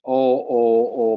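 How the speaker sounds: ordinary delivery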